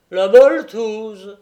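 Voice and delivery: loud, high, drawn-out echo; relieved exclamation. A narrator's reading. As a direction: exclaim like a weight has lifted. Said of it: "de l'accent rassuré d'un homme".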